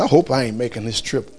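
low, soft worried aside to himself; quiet inner thought. Retold or imitated. Husband worries to himself about spoiling the trip, softly. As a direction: say it under your breath, fretting. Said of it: "And I'm saying".

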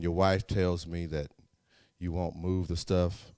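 sit him down like a father; fatherly pastor. Retold or imitated. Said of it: "give him some advice like a pastor would".

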